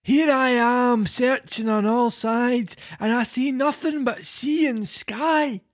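put-on high, slow, fretful old voice; anxious little old man; worried old man. Reads Geppetto's lines.

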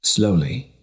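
plain reading voice

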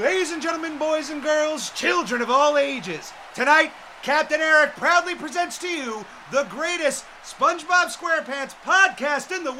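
booming, high circus ringmaster. Acted. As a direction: announce it under the big top. Circus-style announcement of the podcast.

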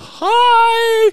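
high, loud friendly yell of hi; loud cheery hi. Retold or imitated. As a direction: shout a bright greeting from the car. Honking and greeting random strangers.

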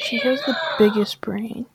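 breathy teasing whisper. Real, not acted. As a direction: whisper it like a secret. Teasing whisper about Loretta.

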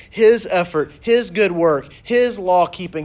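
"his" punched at the start of each phrase; stress on HIS. Shown as a demonstration. Preacher exposing the lawyer's self-salvation project, stress on "his". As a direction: hammer each "his" in the list. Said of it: "The emphasis is on".

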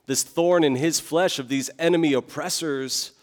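ordinary talk

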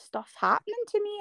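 high, exasperated retold question. Guest retelling her plea to the doctor, high and exasperated. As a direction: throw the question up in disbelief. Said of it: "I'm like".

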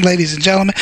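ordinary delivery

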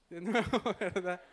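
amused aside broken by laughter; laughing. Real, not acted. Storyteller joking to the congregation about the drunk, laughing.

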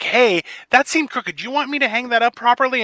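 overly helpful offer. Retold or imitated.

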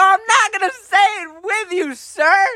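high-pitched mock-indignant protest; retold squeal of protest; indignant refusal.

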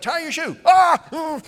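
high, exasperated dad shout; frustrated yell. Retold or imitated. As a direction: yell it like a parent running late. Acting rushed, frustrated father.

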